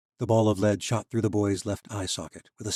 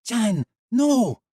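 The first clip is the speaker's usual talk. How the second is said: high, loud cry of alarm; a woman bursting in to stop the boy; pleading shout; 10 dB louder than usual. Acted. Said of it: "at the strange voice".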